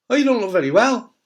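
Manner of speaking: high, loud mock concern; imitated high-pitched remark, too loud; tactless concern. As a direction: exclaim it like a blunt acquaintance. Acts out tactless acquaintances in a shop.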